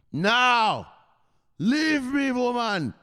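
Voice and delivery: high, loud brush-off; dismissive son. Retold or imitated. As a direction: send mama away. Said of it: "He says".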